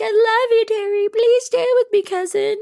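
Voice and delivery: very high, loud, clingy plea; very high loud cousin plea; Nat's pleading voice. Retold or imitated. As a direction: beg your cousin to stay, squeaky and loud. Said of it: "And Nat's like".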